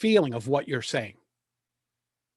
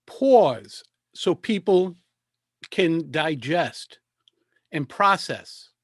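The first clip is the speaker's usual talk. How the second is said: slow, deliberate, pausing between phrases; slow with pauses; much slower than usual. Shown as a demonstration. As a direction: let each phrase land before the next. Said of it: "is that you don't pause".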